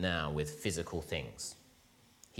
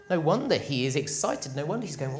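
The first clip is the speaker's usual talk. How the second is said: ordinary talk, nothing marked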